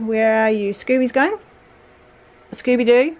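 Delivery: ordinary delivery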